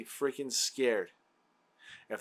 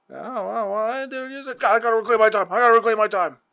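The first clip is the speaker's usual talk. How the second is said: higher, louder mock lawmaker talking over the witness; climbing, louder mock rant of a congressman grabbing the floor; mocking a flustered politician; 8 semitones higher, 9 dB louder, faster than usual. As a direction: ham up a politician cutting someone off. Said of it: "people don't talk like that".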